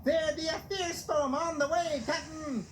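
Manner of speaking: mocking sailor voice. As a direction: squeak it out to mock him. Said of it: "Salty knew they were making fun of him".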